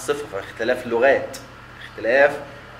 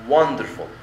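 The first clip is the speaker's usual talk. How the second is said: ordinary delivery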